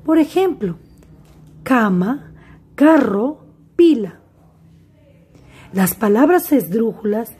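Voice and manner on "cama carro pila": cama, carro, pila, each hit on its first syllable; penultimate stress. Shown as a demonstration. Teacher gives examples of graves, penultimate stress. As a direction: list cama, carro, pila separately, weight on CA, CA, PI. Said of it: "se acentúan en la penúltima sílaba".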